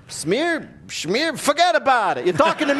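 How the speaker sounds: high hammed-up New York gangster; New York tough guy accent. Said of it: "Ford's response".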